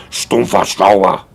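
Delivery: slow, angry German-accented name. Shown as a demonstration. Streamer showing how angry German sounds, angry German accent. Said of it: "sounds so angry when you say it in a German accent".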